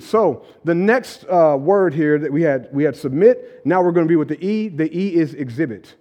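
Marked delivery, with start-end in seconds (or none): none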